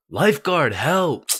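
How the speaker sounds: high, loud cry for help; calling for help. Retold or imitated. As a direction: yell for the lifeguard, high.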